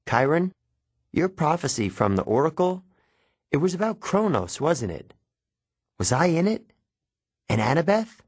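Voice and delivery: high-pitched, searching questions; urgent questioning. Acted. Boy pressing his mentor about a prophecy, urgent questioning. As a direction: press for an answer, pitch climbing on each question.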